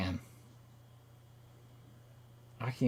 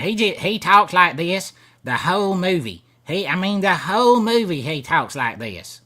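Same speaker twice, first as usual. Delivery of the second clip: hammed-up Kentucky accent; 6 semitones higher, 7 dB louder than usual. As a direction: ham up the Kentucky accent like the movie guy. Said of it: "playing up the Kentucky accent".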